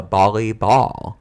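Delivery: volleyball drawn out as bolleyball; slowly and loudly start volleyball with a B. Real, not acted. Concluding her defence of the word, B for V. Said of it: "because you're bouncing a ball in the air".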